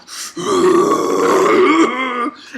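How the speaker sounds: loud, long high gasp of shock. Retold or imitated. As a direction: gasp as if stunned. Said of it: "he was like".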